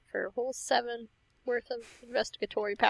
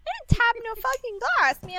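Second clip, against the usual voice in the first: indignant denial; 10 semitones higher, 4 dB louder than usual. Acted. Player denies tapping the glass.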